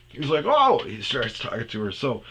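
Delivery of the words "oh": high loud surprised oh. Retold or imitated. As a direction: react high and startled. Surprised reaction.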